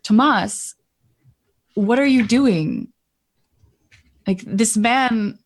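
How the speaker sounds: slow, puzzled questioning; mildly bewildered, drawing the words out; confused. Acted. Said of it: "she looks at him confused".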